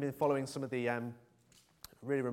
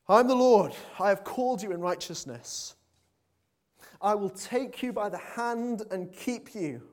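booming, much louder and higher proclamation, slowing at the end; voice of God the Father; 8 semitones higher, 9 dB louder, slower than usual. Acted. Preacher voicing the Father to the Son, booming proclamation. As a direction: proclaim it as the Lord calling his servant. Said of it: "Here's the Father speaking to the Son again".

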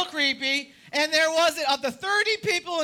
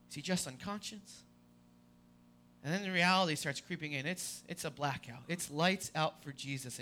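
hushed sermon; 9 semitones lower, 11 dB quieter than usual. Acted. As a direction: draw them into the silence of the tomb. Hushed dramatic sermon passage.

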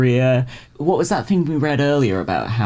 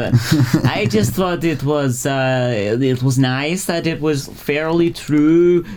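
put-on higher slow odd accent; Milkman voice; 5 semitones higher, slower than usual. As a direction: do your Milkman voice as Wiseau. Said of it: "I'm just doing my Milkman voice again".

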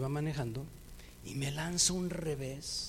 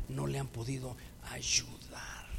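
soft whisper of despair; whispered plea; 3 semitones lower, 8 dB quieter than usual. Retold or imitated. The father tells Jesus the disciples could not heal his son, whispered. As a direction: let it fall to a whisper.

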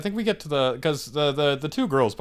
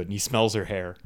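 usual speaking voice

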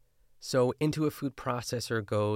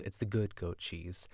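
ordinary talk

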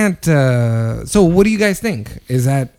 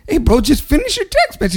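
much higher, pleading "bro"; exasperated plea; 11 semitones higher than usual. Retold or imitated. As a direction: beg him to just finish the text. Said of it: "and I'm like".

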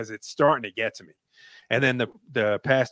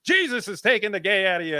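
high, loud mock faith healer; mock a showy revival preacher casting something out; fake evangelical; 9 semitones higher, 5 dB louder than usual. Mocking fake evangelicals healing people in church, high loud preacher. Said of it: "like, the fake evangel-, e-, evangelicals".

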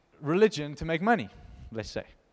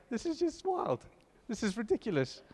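amazed disbelief; 8 semitones higher, 4 dB quieter than usual. Real, not acted. Preacher stunned by the Romans passage, high amazed voice. Marveling at the verse.

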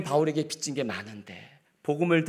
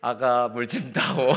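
father-in-law; slower than usual. Shown as a demonstration. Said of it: "제가 진짜 꼭 해보고 싶은 게요".

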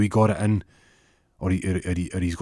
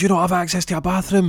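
high-pitched, shocked exclamation; incredulous judge; 10 semitones higher, 4 dB louder than usual. Acted.

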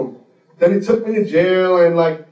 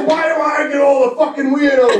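exasperated mock shout, pitched up; higher-pitched fed-up outburst, acted out; going nuts; 4 semitones higher than usual. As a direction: imitate a cop losing it. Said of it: "He went fucking nuts".

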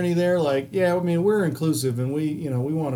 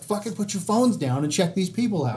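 fed-up imitated rant, pitched higher; venting at the gabbing cashiers; a fed-up customer's silent outburst; exasperated inner rant; 5 semitones higher than usual. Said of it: "but I'm like".

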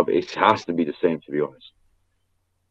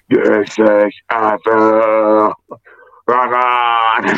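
very slow, stretched rock-singer drawl; showing off a put-on rock voice after a concert; much slower than usual. Shown as a demonstration. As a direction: drag every word out like a rock frontman. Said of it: "Let me put my rock voice on".

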